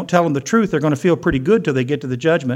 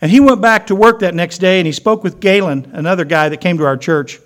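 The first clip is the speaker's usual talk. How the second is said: normal talking voice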